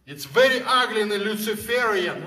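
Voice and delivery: usual speaking voice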